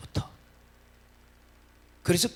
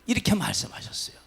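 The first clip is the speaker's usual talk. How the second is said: normal talking voice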